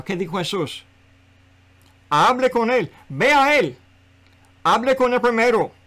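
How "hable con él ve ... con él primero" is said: high, loud preacher's exhortation; urgent pulpit command, punched out loud; emphatic command. Real, not acted. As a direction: drive the instruction home forcefully. Preacher drives home Jesus's command.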